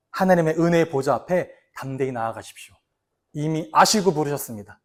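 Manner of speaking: low, hushed reading of the verse; soft scripture reading. Acted. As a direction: read it softly like a blessing. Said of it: "이렇게 말씀하시죠". (성경 구절을 낮고 조용하게 낭독)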